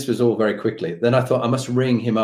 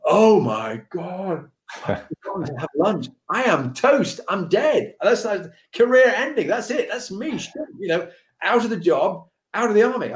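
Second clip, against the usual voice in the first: panic; 7 semitones higher than usual. Retold or imitated. Panicking on realising missed lunch.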